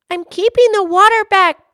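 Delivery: loud, high yell. Acted. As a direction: yell up from below the wall. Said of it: "Peter yelled".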